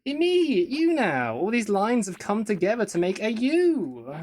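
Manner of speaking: high, loud mock wonder; hammed-up awe at a simple drawing; mock amazement.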